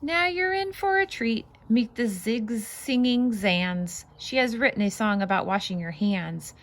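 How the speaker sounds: lively, bouncy read-aloud voice; singsong picture book reading; reading to children. Acted. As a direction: read it out like a storybook for little ones.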